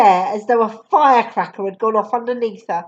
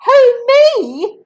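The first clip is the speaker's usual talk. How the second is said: put-on much higher, louder child's question; startled child; 12 semitones higher, 7 dB louder than usual. Matilda answering the headmistress, high and startled. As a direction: sound caught off guard. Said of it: "Matilda said".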